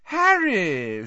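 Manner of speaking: high, loud, gushing greeting; beaming greeting. A narrator's reading. Reporter spotting Harry in the pub, beaming. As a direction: greet him with a beam.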